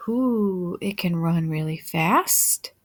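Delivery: ordinary delivery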